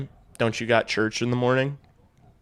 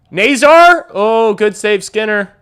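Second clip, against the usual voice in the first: much higher, louder cry of the name, easing into good save; excited shout; 10 semitones higher, 10 dB louder, slower than usual. Real, not acted. Streamer yelling as Skinner robs Nazar. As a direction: yell as he's robbed.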